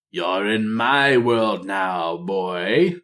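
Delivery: slow, mocking voice of a troll Loa; jeering troll spirit. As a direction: lean into the taunt, savour each word. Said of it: "Bwonsamdi jeered".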